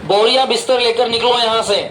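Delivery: ordinary talk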